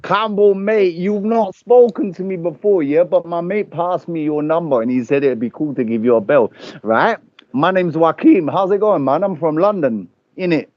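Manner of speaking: brash London lad calling a stranger; Joaquin, London lad. Acted. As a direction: play a brash London bloke on the phone. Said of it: "so I'm Joaquin".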